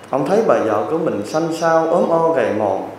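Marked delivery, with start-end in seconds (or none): none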